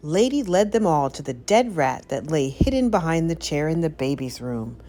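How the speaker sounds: ordinary delivery, nothing marked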